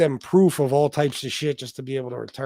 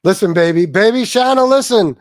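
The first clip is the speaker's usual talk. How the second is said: loud, higher urgent plea; loud pleading; 5 semitones higher, 8 dB louder than usual. Real, not acted. Husband urgently begs wife Shanna to listen, loud and high. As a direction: plead over the noise for her to listen.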